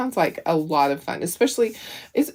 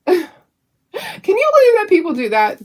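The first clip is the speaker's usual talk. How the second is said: incredulous; 12 semitones higher, 4 dB louder than usual. Real, not acted. Own outrage at the repairman.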